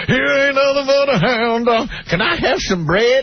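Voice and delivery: high, thin put-on old bluesman; high mock singer voice. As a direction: play the poor songwriter begging. Impersonating the poor songwriter.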